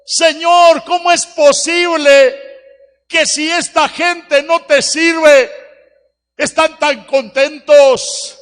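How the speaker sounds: loud, high anguished plea to God, half-crying; retold tearful prayer. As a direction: cry out to the Lord in grief. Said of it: "le dije al Señor llorando".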